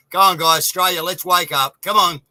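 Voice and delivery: loud rousing call to everyone; fired-up rallying cry to the listeners; rallying shout. Real, not acted. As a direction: shout it out to rally the whole country.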